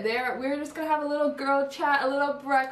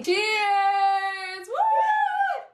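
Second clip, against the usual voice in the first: cheering; 9 semitones higher, 4 dB louder than usual. Real, not acted. Toasting cheer.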